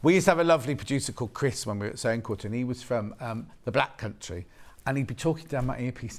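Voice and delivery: normal talking voice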